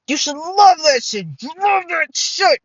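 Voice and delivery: high, slowing mock insistence; emphatic insistence. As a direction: press it on them, drawing out the repeat. Mock insisting that listeners love it.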